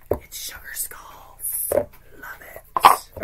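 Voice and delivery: hushed, slow admiring whisper. Real, not acted. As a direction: whisper it like a secret treasure. Admiring favorite deck.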